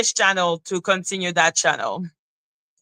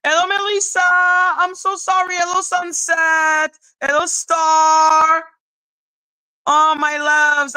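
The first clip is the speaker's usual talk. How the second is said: singsong greeting; 6 semitones higher than usual. Real, not acted. Streamer greeting viewers as they join the live chat, singsong greeting. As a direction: call out each hello high, stretching the last syllable.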